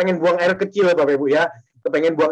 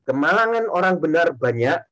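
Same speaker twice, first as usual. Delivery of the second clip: scripture reading; 3 semitones lower, slower than usual. Shown as a demonstration. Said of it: "Sekali lagi saya baca".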